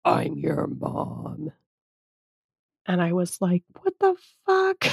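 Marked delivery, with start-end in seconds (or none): 0.1-1.5 low, very slow, eerie voice of the intruder; eerie, slowed-down ghostly voice claiming to be mom; creepy entity voice. Retold or imitated. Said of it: "my husband does the best interpretation of this". 3.8-4.9 much higher, startled outburst; stunned, high-pitched what-the; shocked disbelief. Retold or imitated. Shocked reply to the spirit.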